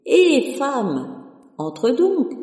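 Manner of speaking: loud call down the stairs; a shout to the woman on the stairs, inviting her in. A narrator's reading. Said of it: "cria dans l'escalier".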